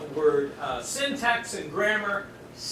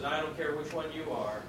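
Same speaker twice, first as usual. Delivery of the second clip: Jesus to the grieving, softly reassuring; tender promise; 6 semitones lower, 10 dB quieter than usual. Retold or imitated. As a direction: reassure gently, word by word. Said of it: "and Jesus says".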